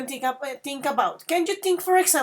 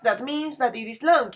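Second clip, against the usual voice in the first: ordinary delivery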